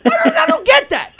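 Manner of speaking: normal talking voice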